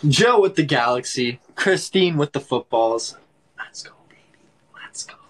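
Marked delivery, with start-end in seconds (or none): 3.6-5.2 whispered cheer. Real, not acted. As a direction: hush it right down to a whisper.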